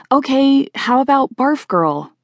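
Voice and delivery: high-pitched, drawn-out prompt; tentative suggestion. Acted. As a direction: offer it hopefully, slowing at the end. Suggesting her nickname after no response.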